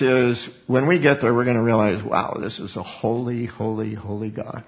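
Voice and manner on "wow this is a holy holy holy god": soft, awestruck hush; whispered awe at God's holiness. Pretend. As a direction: breathe it in quiet wonder. Awed believers in heaven.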